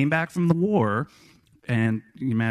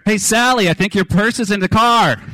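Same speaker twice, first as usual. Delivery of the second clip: higher, louder call across a crowded room; teasing shout; 9 semitones higher, 7 dB louder than usual. Retold or imitated. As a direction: razz him loudly from across the restaurant. Uncle yells across crowded restaurant.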